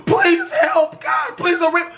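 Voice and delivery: shouted, high begging; mock hysterical girl; hysterical pleading. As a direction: beg in hysterics. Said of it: "she's freaking out".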